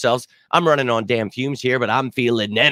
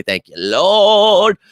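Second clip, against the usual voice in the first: exultant praise; 9 semitones higher, 7 dB louder, much slower than usual. Real, not acted. Relief it's Friday.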